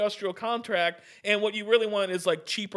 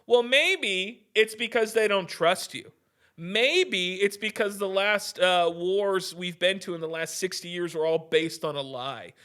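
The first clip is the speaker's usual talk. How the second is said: ordinary delivery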